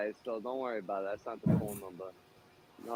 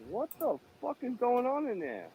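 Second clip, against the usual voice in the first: stunned disbelief; 12 semitones higher than usual. Real, not acted. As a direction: blurt it out in shock. Amazed at the massage.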